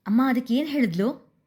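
eager, cutting-in question; interrupting her sister, eager to know; curious. A narrator's reading. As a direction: ask it with keen curiosity. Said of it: "ಕುತೂಹಲದಿಂದ ಕೇಳಿದಳು".

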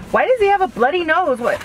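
high panicked voice; frantic high question. Retold or imitated. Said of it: "We're like".

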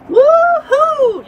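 loud, high whoop of joy; boy hollering from the water; whooping yell. Acted. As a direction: let out a big splashing cheer. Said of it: "yelled Nathan".

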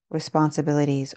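ordinary talk, nothing marked

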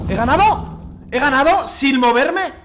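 agudo, incrédulo, subiendo hacia la risa; pregúntalo maravillado, con la voz más alta; asombro eufórico. Real, not acted. Asombro creciente por ganar quieto. (incredulidad feliz)

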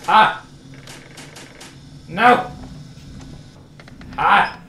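loud, strained cries of annoyance; frustrated yells. Real, not acted. Player yelling at the drawer as grabs keep failing, frustrated yells. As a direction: burst out at each miss. Repeated failed grabs in game.

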